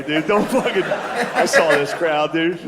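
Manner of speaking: ordinary talk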